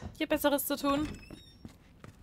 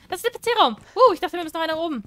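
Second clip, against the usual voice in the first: high, loud burst of excitement; sudden excited shout, quick at the end; excited discovery; 6 semitones higher, 9 dB louder than usual. Real, not acted. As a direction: blurt it out, thrilled to find it. Finding the PC room.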